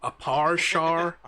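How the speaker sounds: "parshar" drawn out slowly with Belfast vowels. Shown as a demonstration. Player demonstrating the key phrase, slow Belfast "parshar". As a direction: stretch "parshar", Belfast for power shower. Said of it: "that gives you the vowels".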